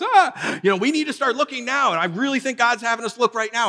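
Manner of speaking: high, pestering mock wife voice; hammed-up high nagging wife; nagging wife impression. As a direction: squeak it out like a nagging spouse popping up everywhere. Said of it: "she jumps out of the bread box".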